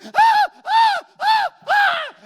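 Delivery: high, loud yelps of fake pain; hammed-up screams of a victim; mock agonized screams. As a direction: shriek like you're being dragged off. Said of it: "he's writhing around going".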